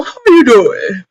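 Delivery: slow drawn-out sassy greeting; lightly voiced drag queen; campy drag queen. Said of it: "is said in a drag queen voice".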